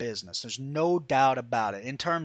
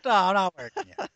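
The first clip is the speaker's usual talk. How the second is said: smile in voice; 3 semitones higher than usual. Acted. Worker keeps denying he's working you, audibly smiling. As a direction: protest innocence with a grin you can hear. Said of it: "I can hear the smile in your voice".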